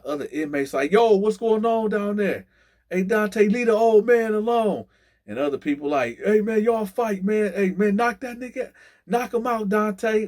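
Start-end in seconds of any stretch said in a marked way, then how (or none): 0.9-4.9 loud, high call down the tier. Retold or imitated. Inmates calling out from cells. 6.2-10.3 egging on. Retold or imitated. Inmates egging on fight.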